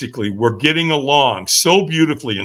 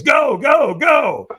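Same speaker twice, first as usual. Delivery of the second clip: mock shout; 9 semitones higher, much slower than usual. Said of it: "he goes".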